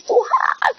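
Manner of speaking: drawn-out, high-pitched mock wail; pained cry. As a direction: re-enact crying out from unbearable pain. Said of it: "saking sakitnya itu saya sampai teriak".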